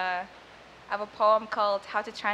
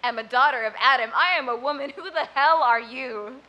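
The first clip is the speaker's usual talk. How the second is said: defiant mother; 7 dB louder than usual. Retold or imitated. Mother defying soldiers at gunpoint, loud defiant challenge. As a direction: stand tall and challenge the soldiers. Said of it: "she said".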